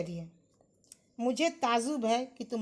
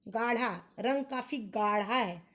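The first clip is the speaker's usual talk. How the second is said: ordinary delivery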